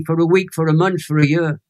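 ordinary talk

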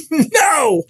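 put-on high, loud childish refusal; screamed no. Boy refusing the preacher, screaming no. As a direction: yell the refusal like a scared boy. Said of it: "I screamed real loud".

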